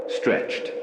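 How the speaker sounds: usual speaking voice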